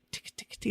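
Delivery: unvoiced tick tick tick; whispered ticking. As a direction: breathe the ticks without voice. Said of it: "Joe goes like this".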